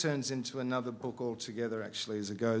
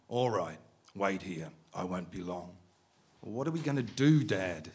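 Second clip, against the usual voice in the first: steady, measured reading of a novel; story reading; 5 dB louder than usual. A narrator's reading. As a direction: read it like an author at a bookshop. Author resumes reading novel.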